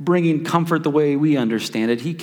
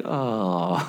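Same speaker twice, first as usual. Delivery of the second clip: deflated oh; 5 semitones lower, 6 dB quieter than usual. Retold or imitated. Deflated at losing award.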